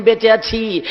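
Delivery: usual speaking voice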